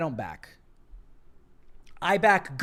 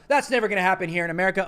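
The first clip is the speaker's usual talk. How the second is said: fast mocking quote; 4 dB louder, much faster than usual. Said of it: "conservatives go".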